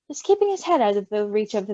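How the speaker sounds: plain reading voice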